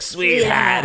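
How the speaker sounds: high, loud Jersey gal; loud mock Jersey sweetheart; Jersey accent. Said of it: "Or from Boston".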